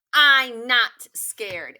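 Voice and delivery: loud, slow, stamping out every word; big bold NOT, said slow and loud; emphatic denial. Acted. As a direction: insist hard that you are not scared. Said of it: "look how it's all bold-faced".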